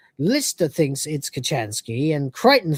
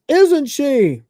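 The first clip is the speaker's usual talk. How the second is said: high, loud insistent demand; hammed-up jealous challenge; jealous accusation; 9 semitones higher, 6 dB louder than usual. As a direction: press the accusation in mock outrage. Crichton repeats his jealous accusation.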